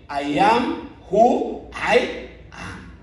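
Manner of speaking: slow, word by word; solemn divine declaration. Acted. Preacher quotes God answering Moses, slow and solemn. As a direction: weigh each word as God's own answer.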